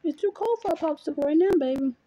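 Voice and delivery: ordinary delivery, nothing marked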